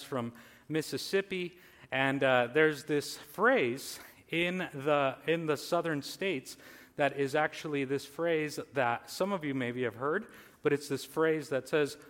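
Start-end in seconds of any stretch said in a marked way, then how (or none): none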